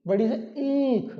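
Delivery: बड़ी से ईख with the ई of ईख held long and slow; long ई demo. Shown as a demonstration. Teacher drawing out ईख to show a long vowel. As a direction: stretch the ई in ईख. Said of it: "छोटी से इमली".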